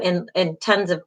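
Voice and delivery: normal talking voice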